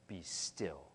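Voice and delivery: ordinary delivery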